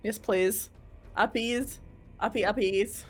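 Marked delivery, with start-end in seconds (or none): none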